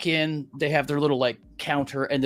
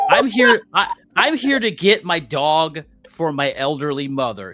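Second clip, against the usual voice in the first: indignant retort; 3 semitones higher, 7 dB louder than usual. Retold or imitated. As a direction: re-enact standing your ground with the groomer. Retold argument with the dog groomer.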